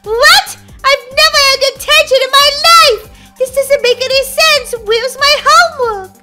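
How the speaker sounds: high, loud, indignant disbelief; outraged protest. Acted. Daisy gets her first detention, outraged protest. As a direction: protest loudly at the unfairness.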